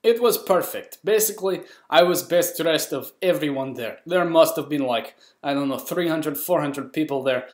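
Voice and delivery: comic Russian accent; lively put-on Russian voice. Shown as a demonstration. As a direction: carry on telling it in a Russian accent. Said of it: "Now you get my Russian accent".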